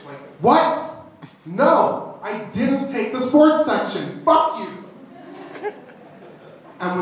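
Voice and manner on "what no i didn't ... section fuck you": ranting loudly at nobody, slowing down; loud crazy ranting. Retold or imitated. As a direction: yell at an invisible person. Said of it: "having a loud, boisterous conversation with themselves".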